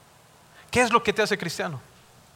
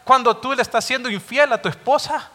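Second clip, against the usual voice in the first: higher, louder, stern rebuke; God rebuking the unfaithful husband, thundering; God's rebuke; 6 semitones higher, 5 dB louder than usual. Acted. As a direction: scold the hypocrite with fire. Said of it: "lo mismo que le está diciendo".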